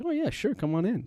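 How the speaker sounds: squeaky high "oh yeah sure"; high chirpy welcome. Retold or imitated. Billy invites the fan inside, high and chirpy. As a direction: lift it way up, breezy and eager.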